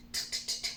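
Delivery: "t" as bare voiceless taps, no vowel; crisp repeated "t" without voice; isolated T sound. Shown as a demonstration. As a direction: tick out each "t" softly and separately. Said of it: "Last sound".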